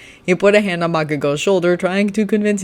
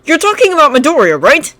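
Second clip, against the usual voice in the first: put-on higher, questioning boy's voice; supposing; 11 semitones higher, 7 dB louder than usual. Kirishima guessing who they mean, higher voice. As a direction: check it, a bit unsure. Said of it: "supposed Kirishima".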